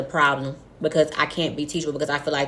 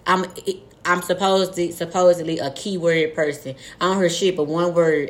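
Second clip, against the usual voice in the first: normal talking voice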